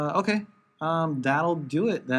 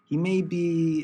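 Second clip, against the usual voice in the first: ordinary delivery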